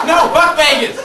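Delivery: high, loud, emphatic refusal. Pretend. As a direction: shout it with full force.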